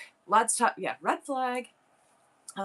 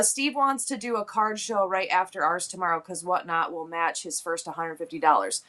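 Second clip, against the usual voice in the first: normal talking voice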